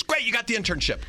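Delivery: high chirpy New York lady. Retold or imitated. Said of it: "She goes".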